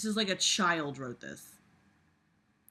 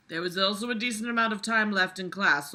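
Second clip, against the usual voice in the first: normal talking voice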